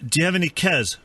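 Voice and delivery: lightly put-on South African accent, deadpan; mock South African accent. Prank caller playing neighbourhood watch, mock South African accent. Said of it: "It was South African".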